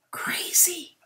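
breathy whispered remark to herself; hushed whisper of disbelief; whispered aside. Real, not acted. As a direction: whisper it in awe. Aside after reading screams, explosions.

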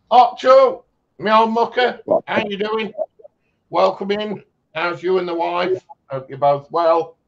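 high, loud happy welcome; excited greeting. Real, not acted. Greeting a friend in chat.

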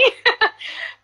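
short unvoiced laugh; sheepish laugh; breathy laugh. Real, not acted. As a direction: laugh it off, a little embarrassed. Guilty laugh about accident.

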